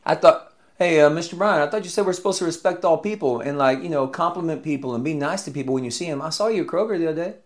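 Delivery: a hint of a tattling student, rushed at the end; lightly voiced student. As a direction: catch the teacher out like a smug student. Student calling out the teacher.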